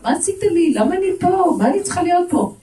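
high, loud protest; acted outburst of grievance; complaining. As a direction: whine loudly in indignant complaint. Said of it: "אני אבוא בטענות".

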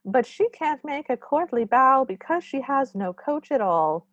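high-pitched little gnome voice; toddler-voiced chief gnome. Chief gnome telling his men the problem, toddler's voice. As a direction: fret like a toddler making a speech. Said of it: "so our chief gnome continues".

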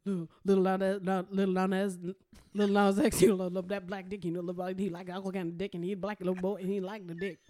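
low, monotone Cajun auctioneer patter. Pretend. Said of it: "How would a Cajun engineer say it?".

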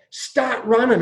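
a retold coach laying down the law; stern coach orders. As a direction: bark orders at the hungover players. Coach orders hungover players.

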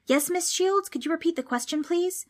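high-pitched, polite little pupil voice; startled schoolboy. A narrator's reading. Boy waking, thinks he is in class.

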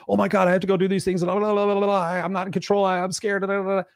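lightly hammed-up worried inner voice; panicky stress voice. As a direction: rattle it off like your own anxious mind talking. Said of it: "stress can sit there and say".

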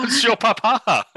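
put-on hammy French accent. Echoing French title in accent.

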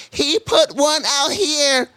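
very high, slow tattletale call; cleaning lady. Retold or imitated. Telling police about another hidden packet, mock high voice. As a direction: stretch it out in a high mimicking voice. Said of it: "they said".

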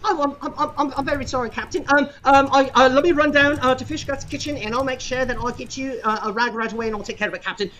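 high, stammering apology; flustered cabin boy. Acted. Cabin boy stammers apologies to the angry captain, high and flustered. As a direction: stumble over your sorry, eager to please.

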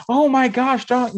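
high, loud gushing mom; mock starstruck mother; excited mom. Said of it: "she was like".

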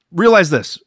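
ordinary delivery

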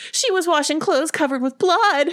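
very high, loud panicked report; horrified. A narrator's reading. Sybil finishing the account of the bloody washing, horrified. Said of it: "Sybil finished".

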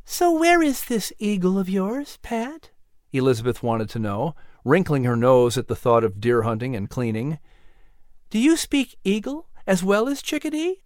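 put-on girl character. A narrator's reading. Narrator voices Elizabeth.

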